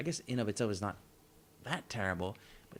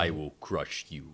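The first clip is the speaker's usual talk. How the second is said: Kingpin voice; 6 semitones lower than usual. Pretend. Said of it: "That's a good Kingpin".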